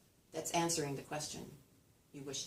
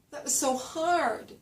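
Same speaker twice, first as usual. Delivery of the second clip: higher, louder, slower with a glide on "hard"; stock complaint melody; 9 semitones higher, 5 dB louder, slower than usual. Retold or imitated. Somebody reporting how hard it was, stock melody. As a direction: lean on "hard" and slide it. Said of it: "So somebody will say".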